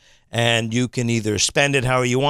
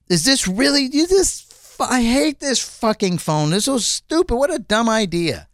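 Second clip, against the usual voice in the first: high-pitched indignant rant; real exasperation; 7 semitones higher than usual. Real, not acted. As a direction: vent your disgust, pitched high. Rant at the hated phone.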